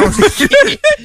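high snorting giggle; snorting laughs. Real, not acted. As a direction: let out a piggy snort laugh. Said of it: "j'ai échappé un rire de cochon".

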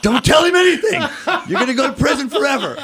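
high, loud mock-panicked warning; retold paranoia. Voices paranoid thoughts toward agent.

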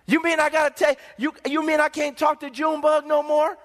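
high, stammering protest rising at the end; incredulous convert. Acted. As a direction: play a dismayed convert pleading. Convert protests giving up a friend.

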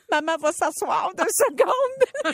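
high, drawn-out mock plea of a tired mom; worn-out mom. Mom telling her young daughter she must sit down, mock worn-out. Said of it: "je suis pas capable de la suivre".

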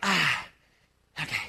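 ordinary talk

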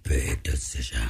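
very low, slow, mock-portentous; deep dramatic voice. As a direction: boom it out like a trailer voice.

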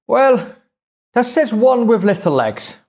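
high-pitched mock Irish accent. Teller quotes the man delivering the punchline, Irish accent. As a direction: imitate an Irishman, pitched well up. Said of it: "I apologise for the accent".

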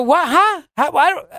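high, stunned "What? Huh?" in mock confusion; baffled disbelief. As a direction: act flabbergasted, voice jumping up. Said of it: "You go".

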